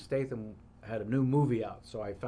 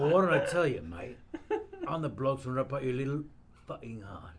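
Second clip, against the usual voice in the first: Cockney hardman voice; threatening London geezer; Jason Statham impression; 2 semitones higher than usual. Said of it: "how to do Jason Statham".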